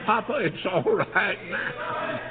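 put-on boy's joyful news called out, high and slow; exultant shout. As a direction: preach the good news out, drawn out. Boy's good news, preacher exulting.

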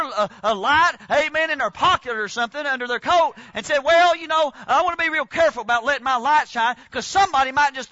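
high, loud revival preaching; preaching at full force. Acted. Preacher exhorting the church to let its light shine, full force. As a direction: rear back and preach it hard.

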